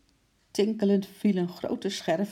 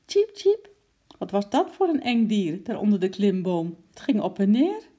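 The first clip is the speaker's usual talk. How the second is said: high, louder anxious squirrel voice; a scared little squirrel asking what that monster is; frightened squeaking; 5 semitones higher, 6 dB louder than usual. A narrator's reading. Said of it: "Angstig piepend".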